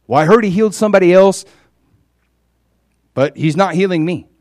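hammed-up grievance, rushed and loud; mock complaint. Mocks the sick man's complaint.

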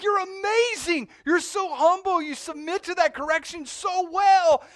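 very high and loud, slow ecstatic praise; a whole city cheering him on, ecstatic; celebrating crowd. Retold or imitated. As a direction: sing out the crowd's wild praise.

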